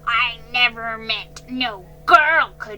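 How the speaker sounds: put-on angry old man shouting back. A narrator's reading. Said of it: "Jiggy shouted".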